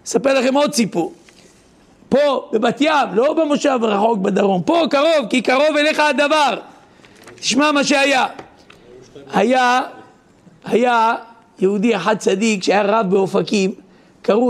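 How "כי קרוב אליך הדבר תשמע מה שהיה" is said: ordinary delivery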